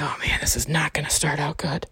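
whispered worry under her breath; whispered aside. Retold or imitated. In the car with silent date, whispered worried aside. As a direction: whisper it so he won't hear.

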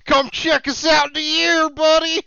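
put-on a lighter Pennsylvania accent for the zoo pitch. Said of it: "The Pennsylvania accent there".

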